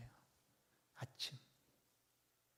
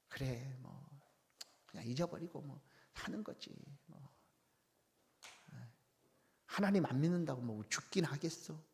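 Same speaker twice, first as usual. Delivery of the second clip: resigned shrug; 5 dB quieter than usual. Pretend. People shrugging off God, imagined.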